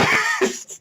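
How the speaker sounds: sharp, breathy laugh; burst of laughter. Real, not acted. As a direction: laugh out at the twist. Laugh after the twist.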